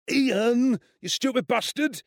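high, pained schoolboy yelp; wailing. A narrator's reading. Bullied friend rounds on Ian after a kick, wailing. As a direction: wail it indignantly, pitched right up. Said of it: "Gavin Hurst wailed".

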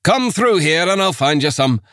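lightly voiced Scots accent, genial; a Scots magistrate steering him to his office. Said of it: "The accent was thick Scots".

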